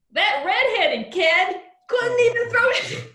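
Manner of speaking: loud, high-pitched, hammed-up Boston accent; bad Boston accent. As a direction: do a bad Boston fan, slow and shouty. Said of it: "The Boston accent hasn't gotten any better".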